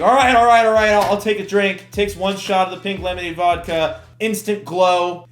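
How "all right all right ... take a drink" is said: imitated roommate giving in loudly. Storyteller as screamed-at roommate Brian, loudly giving in. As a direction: blurt it louder and higher, rushed agreement.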